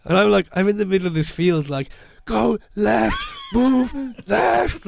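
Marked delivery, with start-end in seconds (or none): 2.3-4.9 drill-sergeant commands; shouting directions. Retold or imitated. Shouting directions at the girl like a drill sergeant. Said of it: "shouting at this poor girl".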